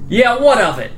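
lightly acted challenge; defiant retort. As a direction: answer back like a touchy teenager. Kid answers a stranger calling him.

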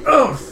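high, loud "ugh" of impact; startled grunt. Acted. Dragonborn jumped from behind, sudden loud grunt. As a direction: grunt as someone lands on your back. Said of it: "is kinda like".

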